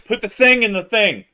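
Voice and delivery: loud, high excited rambling; too excited to find words. Real, not acted. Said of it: "loses the ability to speak".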